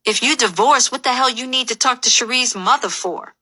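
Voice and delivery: heated outburst, pitched well up; indignant yell. A narrator's reading. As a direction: yell it as a drunken accusation. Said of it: "I yell".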